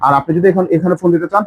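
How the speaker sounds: ordinary talk, nothing marked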